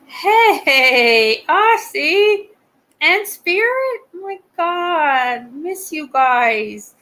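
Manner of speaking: high, loud, delighted hello; calling out to friends in delight; excited greeting. Real, not acted.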